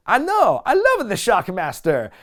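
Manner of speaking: put-on high loud Italian accent; Santino impression. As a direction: squeal it out in a comic Italian accent. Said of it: "And he goes".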